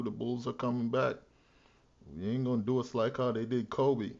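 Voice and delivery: ordinary talk, nothing marked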